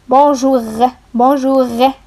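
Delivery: bonjour with a throaty French R, nasal on; French accent. Shown as a demonstration. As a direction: model bonjour like a French speaker. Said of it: "Like the R in French".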